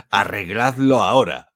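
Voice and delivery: loud, demanding user quote. Retold or imitated. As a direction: bark it like an annoyed customer. Quoting an angry user's demand.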